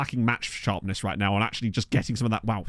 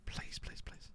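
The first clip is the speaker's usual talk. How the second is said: breathless whisper, almost silent; hushed begging to the screen; whispered plea; 20 dB quieter, much slower than usual. Real, not acted. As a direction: whisper it like a secret prayer. Begging a transfer goes through.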